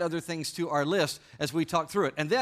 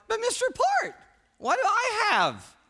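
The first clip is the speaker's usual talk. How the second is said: put-on very high, cracking kid's voice, a bit louder; squeaky boy voice; 6 dB louder, slower than usual. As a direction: plead in a squeaky breaking voice. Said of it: "my squeaky, changing voice".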